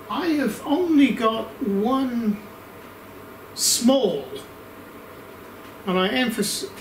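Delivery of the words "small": small set apart by pauses; stress on small. Real, not acted. Reviewer flagging his one caveat, stressing "small". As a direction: lean on "small" as a word of its own. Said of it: "emphasize the word small".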